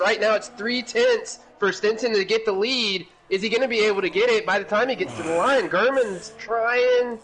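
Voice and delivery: high excited race call; motorsport commentator. Real, not acted. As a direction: build the tension toward the line. Live finish-line play-by-play.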